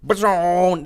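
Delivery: playful imitated whoosh; mock magic blast. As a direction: blurt it like a cartoon zap. Imitating a flashy magic effect.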